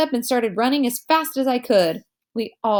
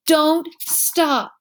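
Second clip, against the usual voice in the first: higher urgent yell; 7 semitones higher than usual. A narrator's reading. Henry yells at Augie while running, urgent. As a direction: keep them running with a yell. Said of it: "would yell".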